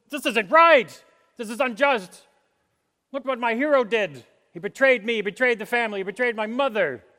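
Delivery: high, loud acted indignation; aggrieved outburst. Wronged relative protesting a betrayal, aggrieved outburst. Voices aggrieved unforgiving person.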